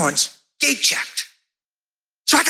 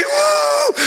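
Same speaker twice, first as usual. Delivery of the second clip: much higher celebratory woo; joyful whoop. Retold or imitated. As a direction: whoop for joy. Woman celebrating on him.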